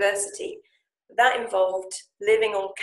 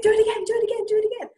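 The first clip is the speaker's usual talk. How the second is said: much higher, louder, eager "do it again"; eager pleading; 13 semitones higher, 4 dB louder, much faster than usual. Retold or imitated. Friend eagerly asking her to repeat the Japanese. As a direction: beg her to show off again.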